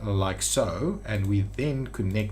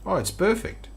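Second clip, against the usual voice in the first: usual speaking voice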